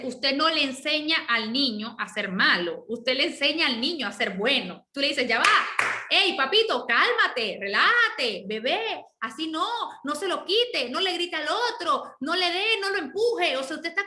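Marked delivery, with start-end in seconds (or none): 5.3-13.6 raised, lilting parental telling-off; parent correcting a toddler. Acted. Parent telling a baby to calm down and behave, firm scolding.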